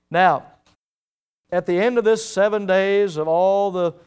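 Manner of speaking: ordinary delivery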